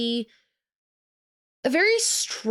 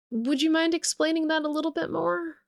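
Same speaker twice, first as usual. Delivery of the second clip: higher-pitched, pointedly polite question; skeptical; 8 semitones higher than usual. Acted. Laura leans forward to press the briefer, skeptical. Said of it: "feeling skeptical".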